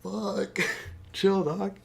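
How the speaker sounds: very high disgusted protest; high grossed-out plea; high squeamish reaction. Retold or imitated. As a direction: squeal at the doctor to chill. Her reaction to skin peeling.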